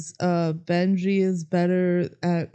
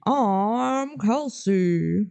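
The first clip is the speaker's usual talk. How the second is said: put-on old-timey voice; much slower than usual. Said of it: "these old-timey voices".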